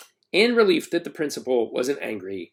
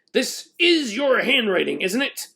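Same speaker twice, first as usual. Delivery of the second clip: indignant; 9 semitones higher than usual. A narrator's reading. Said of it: "the principal continued indignantly".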